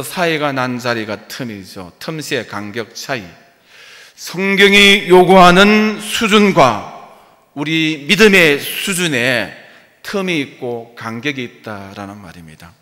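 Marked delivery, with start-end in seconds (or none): 4.1-12.7 louder, higher, slow emphatic preaching; forceful preaching. Real, not acted. Pastor pressing the congregation on their gap in faith, slow and loud. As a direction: drive the point home to the congregation, slowly.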